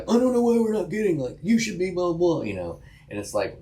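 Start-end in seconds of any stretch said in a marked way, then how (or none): 0.0-2.4 frustrated client. Retold or imitated. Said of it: "you're like".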